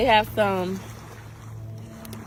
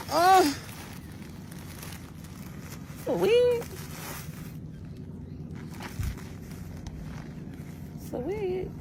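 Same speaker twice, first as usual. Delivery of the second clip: much higher, disbelieving squeal, then hushed; surprised; 11 semitones higher, 8 dB quieter, slower than usual. Real, not acted. Opening Miss Red's package, shocked, then hushed disbelief. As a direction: shriek it, then say it again softly to yourself.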